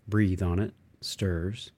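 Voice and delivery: usual speaking voice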